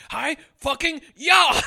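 high, loud, slow battle cry; hammed-up, drawn-out martial arts holler; mock karate yell. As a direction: stretch each word into a karate-chop shout. Acting out Cage's karate chop shout.

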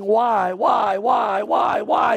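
imitated screaming. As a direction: cry out each why like a screaming baby, retold. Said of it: "he's screaming, saying".

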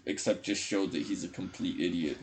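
ordinary talk, nothing marked

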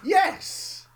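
high, loud exclamation; emphatic yes. Real, not acted. Defending a film as a musical, emphatic outburst. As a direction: exclaim it with total insistence. Insisting South Park is a musical.

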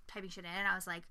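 ordinary delivery

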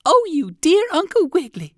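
very high, loud gushing greeting; delighted chick exclaiming. Acted. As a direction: squeal with joy at a friend. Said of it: "she exclaimed".

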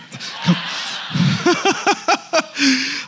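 breathy amused laugh; real light chuckle; a quiet laugh into the mic; soft laugh. Real, not acted. Laughs at congregation response.

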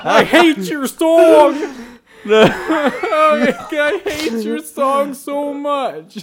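Maui voice. Acted. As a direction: ham up Maui laughing. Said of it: "Maui from Moana".

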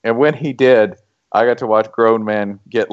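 usual speaking voice

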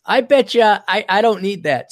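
high, loud, defiant comeback; defiant retort. Retold or imitated. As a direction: snap back like you know better. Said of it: "And I'm like".

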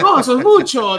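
loud, high scandalized protest; mock shocked reporter. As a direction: imitate a reporter taken aback, voice shooting up.

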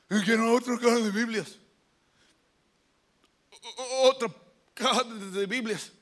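halting, stuttered nasal speech; gangoso nasal voice. Acted. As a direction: honk it through the nose, tripping on syllables. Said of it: "que hablaba así".

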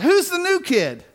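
put-on high, loud little girl's yell; a little girl shouting across the choir room, high and loud; loud child yell. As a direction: shout it out like a sixth grader. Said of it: "in a very loud voice".

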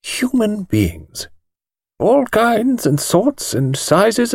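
put-on an old lawyer thinking aloud, unhurried; thoughtful thin voice. A narrator's reading. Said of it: "said Mister Treves thoughtfully".

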